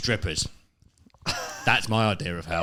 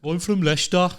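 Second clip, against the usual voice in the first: higher, hammed-up Leicester accent; bad Leicester accent; 7 semitones higher than usual. Teasing the co-host about Leicester in a Leicester accent. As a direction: put on a dodgy Leicester accent. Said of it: "That's brilliant Leicester accent".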